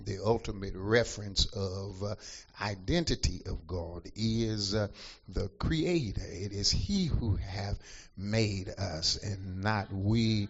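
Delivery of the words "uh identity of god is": ordinary delivery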